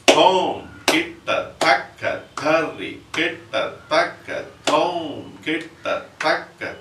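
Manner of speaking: Thom kitataka chanted slow and spread out; slow first speed. Shown as a demonstration. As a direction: stretch each Thom kitataka across the beats. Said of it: "How do we do in the first place?".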